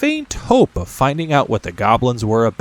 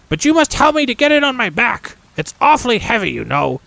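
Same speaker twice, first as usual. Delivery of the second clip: high, loud voice of a hulking goblin lad; young goblin son; 13 semitones higher, 3 dB louder than usual. Acted. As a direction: whine a little about the heavy chest. Goblin son Helfer complains.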